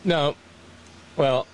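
normal talking voice

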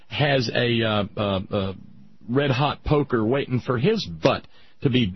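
ordinary talk, nothing marked

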